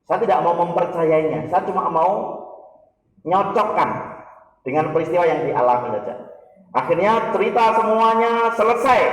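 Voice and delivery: ordinary talk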